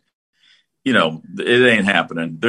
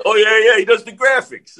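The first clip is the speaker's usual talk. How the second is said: much higher mock Jersey accent, a bit hammed-up; higher-pitched imitation of a Jersey kid's accent; imitating Jersey accent; 11 semitones higher, 4 dB louder than usual. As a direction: do the kid in a put-on Jersey accent, pitched up high. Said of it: "that's not a great Jersey accent".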